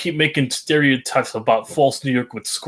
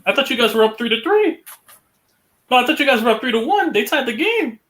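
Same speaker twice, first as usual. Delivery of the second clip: much higher, fast disbelief; surprised; 12 semitones higher than usual. Real, not acted. Streamer reacts to the tied game, surprised and high. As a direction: voice jumps up in surprise.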